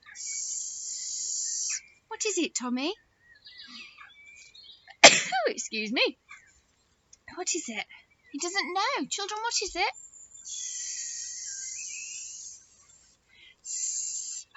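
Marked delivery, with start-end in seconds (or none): 0.1-1.8 sustained soft "sss" hiss; drawn-out S sound; long hissed S. Shown as a demonstration. Teacher hints with the snake's sound. 10.4-14.5 sustained soft "sss" hiss; drawn-out S sounds; long hissed S. Shown as a demonstration. Teacher hints with the snake's sound.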